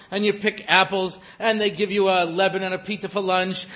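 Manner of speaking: ordinary talk, nothing marked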